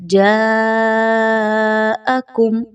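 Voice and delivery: جَاءَكُمْ with the long aa held out; stretched madd. Shown as a demonstration. Teacher demonstrates lengthening in جَاءَكُمْ. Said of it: "مد کو کھینچ کے پڑھیے".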